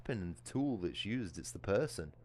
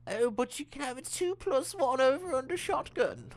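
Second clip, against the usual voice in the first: much higher mocking voice, imitating a smug seller; mocking impression; 7 dB louder than usual. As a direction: sneer at the offer in a much higher, louder put-on voice. Mocking the game's shotgun offer.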